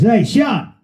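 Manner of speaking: high called greeting in a mate's voice; voicing Piero. Retelling, a high greeting as the bandmate. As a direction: call out high like the keyboard player.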